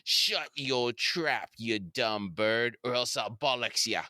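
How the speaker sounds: slow, hammed-up bad Cockney accent; badly faked British accent, drawn out; bad Butcher accent. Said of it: "doing a bad impersonation of a bad accent".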